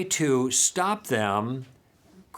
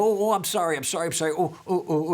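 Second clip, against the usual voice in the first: a quick, higher burst of excited sorries; imitating an overexcited talker who cut in; excited apology; 4 semitones higher, faster than usual. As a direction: gush apologies like an eager talker. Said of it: "they're all excited".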